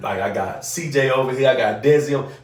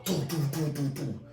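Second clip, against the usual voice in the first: soft vocal door knocks; knocking sound; 4 semitones higher, 9 dB quieter than usual. Retold or imitated. Father imitating his knock on the door, soft vocal knocks. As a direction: tap it out like knuckles on a door.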